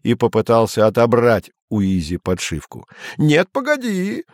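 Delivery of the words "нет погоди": high, slow shout. A narrator's reading. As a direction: cry out to stop him. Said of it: "кричал Изя".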